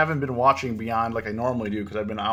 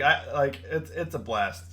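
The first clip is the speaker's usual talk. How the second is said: normal talking voice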